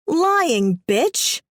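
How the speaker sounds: high shrill accusation; mock indignation. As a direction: fake outrage at the liar. Villain accuses heroine.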